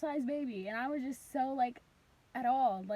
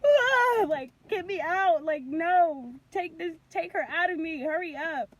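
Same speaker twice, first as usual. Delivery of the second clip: high panicked wail, easing into pleading; losing it; 5 semitones higher, 10 dB louder, slower than usual. Retold or imitated. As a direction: re-enact screaming, then begging in labor. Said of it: "I lost it".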